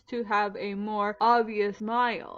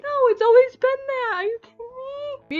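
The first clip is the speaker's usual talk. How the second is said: high, louder outraged protest; indignant outcry; 12 semitones higher, 5 dB louder, much faster than usual. Real, not acted. Vlogger refusing the corrected quote, high indignant outcry. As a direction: protest in disbelief.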